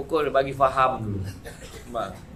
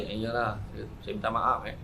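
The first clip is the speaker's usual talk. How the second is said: sheepish apology; 6 semitones lower, 10 dB quieter than usual. Acted. Embarrassed man apologizing.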